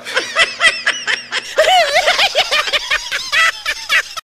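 high loud laugh. Real, not acted. Teller cracking up at the Pepito joke, high laugh.

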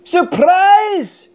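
spring the surprise on him; mild mock shout. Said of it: "shouted his friends".

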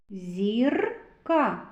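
зірка with a long rolled r before ka; stressed r sound. Shown as a demonstration. Teacher picking out a consonant, rolled r in зірка. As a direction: roll and hold the r in зірка. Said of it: "Р твердий приголосний".

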